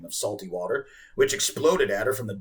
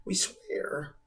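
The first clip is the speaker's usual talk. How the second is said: slow frightened whisper; scared whisper; 6 semitones higher, 6 dB quieter, much slower than usual. A narrator's reading. Boy swearing to the whale, whispered in fear. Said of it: "staggering a fearful apology".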